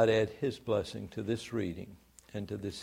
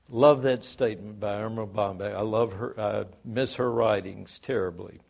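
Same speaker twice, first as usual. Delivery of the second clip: ordinary talk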